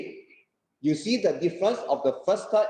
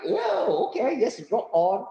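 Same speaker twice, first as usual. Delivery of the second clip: excited; 4 semitones higher, slower than usual. Acted. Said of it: "So he will also like".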